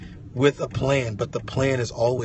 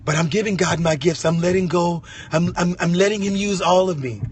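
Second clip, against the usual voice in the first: acted believer; 6 semitones higher, 4 dB louder than usual. Acted believer speaking to God.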